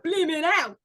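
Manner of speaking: playful put-on British voice; mock British accent. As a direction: exclaim like a cartoon Brit. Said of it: "'Cause he's apparently British now".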